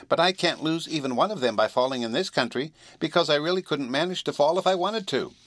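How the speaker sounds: high-pitched little cat; small, high voice, explaining; small kitten voice. A narrator's reading. Kitten explaining to the girl, in a small high voice. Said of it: "the small voice of the kitten".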